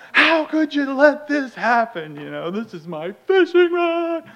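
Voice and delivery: high, loud anguished yelling; retold meltdown. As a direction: wail in over-the-top outrage. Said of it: "I lose my mind".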